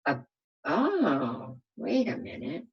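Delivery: ordinary delivery, nothing marked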